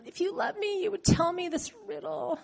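a hammed-up sulk to get the secret; mock whiny plea. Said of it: "you know how you do it".

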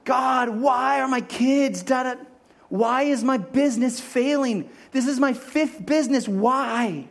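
a theatrical whining complaint to God; mock crying. Spouse complaining to God about marriage, hammed-up wailing. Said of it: "mock complaint to God".

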